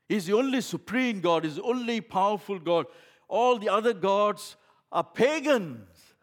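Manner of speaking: loud, high, rousing call to join in; rally the congregation, voice ringing; full-force preaching. Acted. Declaring the only true God.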